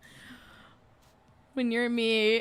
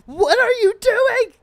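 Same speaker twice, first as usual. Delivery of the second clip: high, loud mock-outraged yell; screaming; 11 semitones higher, 10 dB louder than usual. Yelling at an anime girl confessing love, screaming. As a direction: scream it like scolding someone upstairs. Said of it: "Justin hears me screaming from upstairs".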